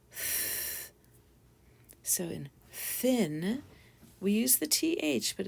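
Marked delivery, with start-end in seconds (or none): 0.1-0.9 soft breathy "th" with no voice; just air through the teeth for "th"; whisper-quiet unvoiced "th". Shown as a demonstration. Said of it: "slightly different sounds".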